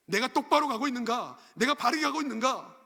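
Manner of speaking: loud, high self-searching questions; impassioned preaching. Real, not acted. Preacher urging listeners to examine their own path, impassioned. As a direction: fire each question out from the pulpit.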